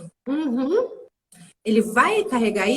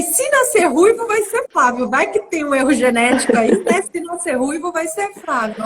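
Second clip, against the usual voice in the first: high and loud, half laughing; comic deal over the baby's name; joking bargain; 5 semitones higher, 5 dB louder than usual. Retold or imitated. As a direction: toss off the deal with a grin.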